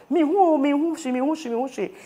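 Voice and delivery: high-pitched, repeated cry; retold shouting. Woman who cannot see cries out to neighbors, retold shouting. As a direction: re-enact a panicked cry, starting high and easing down. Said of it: "I was shouting on top of my voice".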